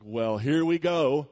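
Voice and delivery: loud, slow, overly bright hello; a bracing "here we go" turning into a loud fake-friendly hi; forced cheery greeting. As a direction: put on a brave, cheerful face and greet them loudly. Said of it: "And I was like".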